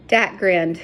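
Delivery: ordinary delivery, nothing marked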